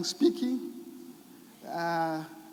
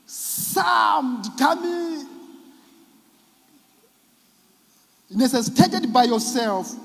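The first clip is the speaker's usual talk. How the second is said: emphatic shout; 4 semitones higher, 4 dB louder than usual. Real, not acted. Emphatic list of losses.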